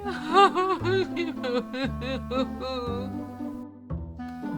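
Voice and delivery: boo-hooing cartoon wail; hammed-up storybook wolf sobs; wolf crying. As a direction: sob in a long, descending boo-hoo. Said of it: "began to cry".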